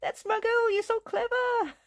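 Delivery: very high cheering voice; very high delighted praise; voicing the spirit husband. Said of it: "He's like".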